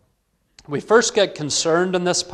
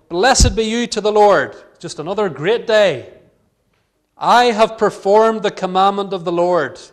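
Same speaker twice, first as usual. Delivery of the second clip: smug Saul; 4 semitones higher, 6 dB louder than usual. Acted. Saul greeting Samuel, pleased with himself.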